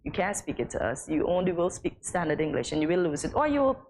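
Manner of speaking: usual speaking voice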